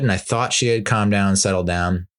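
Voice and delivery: ordinary talk, nothing marked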